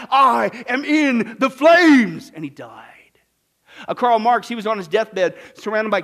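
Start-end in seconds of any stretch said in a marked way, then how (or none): 0.1-2.3 slow, loud drawn-out anguished cry. Retold or imitated. Preacher voicing Hume's dying cry, slow loud anguish. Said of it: "crying out". 2.3-2.9 soft hushed whisper. Acted. Preacher to congregation after the death scene, whispered.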